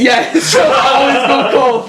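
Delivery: loud, high laughing line; laughing along with a joke; laughing shout. Real, not acted.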